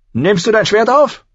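high, loud rising question; wild demand. A narrator's reading. Wine-wild prince challenging the boy, loud demand. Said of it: "Der Wein hatte ihn wild gemacht".

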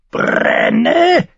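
rolled, squawked cry leaping very high; croaking raven. A narrator's reading. Raven urges John to use the lamp, croaking cry. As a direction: croak it like a raven, rising shrilly. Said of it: "krächzte der Rabe".

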